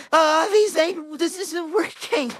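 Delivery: high frustrated complaint. Real, not acted. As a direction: whine that it isn't working. Frustration at failed cure.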